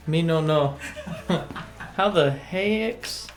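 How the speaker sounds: usual speaking voice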